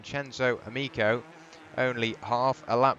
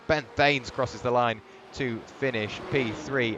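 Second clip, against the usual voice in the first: ordinary talk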